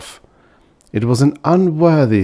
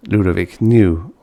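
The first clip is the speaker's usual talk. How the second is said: ordinary delivery, nothing marked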